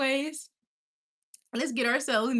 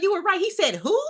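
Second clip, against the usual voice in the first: mock friend reply; 10 semitones higher, 7 dB louder than usual.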